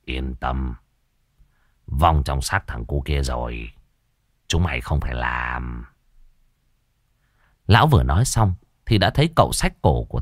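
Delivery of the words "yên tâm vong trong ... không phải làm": quiet amused reassurance. A narrator's reading. Master tells two scared men they are spared, low soft reassurance. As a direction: reassure them softly in a deep register. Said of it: "thầy Phọt mới cười cười".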